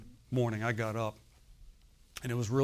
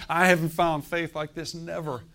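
loud, amazed laugh breaking into the words; laughing with astonishment, pitch pushed up; marveling; 5 semitones higher, 6 dB louder than usual. Acted. Jesus reacts to the centurion's faith, marveling aloud. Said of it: "Jesus marveled".

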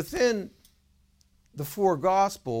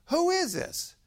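very high-pitched, incredulous question; re-enacted baffled phone answer; acted bafflement. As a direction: demand to know who's calling, pitch way up. Baffled by hysterical caller.

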